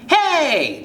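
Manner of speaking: high sharp call to stop. Acted. Jane calling after the fleeing kid, loud. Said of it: "Jane calls to you".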